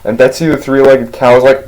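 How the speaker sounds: usual speaking voice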